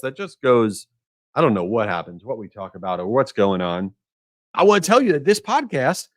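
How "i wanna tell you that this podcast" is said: higher, brighter ad-read voice; sponsor announcer read. Shown as a demonstration. Host introducing sponsor Marigold, announcer read. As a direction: sell the sponsor name like an announcer. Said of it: "That was me saying Marigold".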